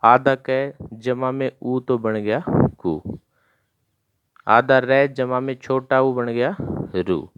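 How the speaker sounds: slow, deliberate letter-by-letter explanation; slow teacher voice building up a word; slow explaining. Shown as a demonstration. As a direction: spell it out slowly for children. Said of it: "कैसे दे रखा है?".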